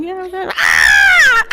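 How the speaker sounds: small babble bursting into a long, high scream; babble, then shriek like a baby, very loud; imitated baby babble into shriek. Storyteller mimicking the recorded baby, babble into piercing scream. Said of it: "the baby would stop and be like".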